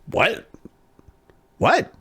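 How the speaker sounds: high, startled one-word questions; baffled. Retold or imitated. Student stunned by teacher's scolding, baffled. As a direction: act out being caught completely off guard. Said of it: "I was so confused".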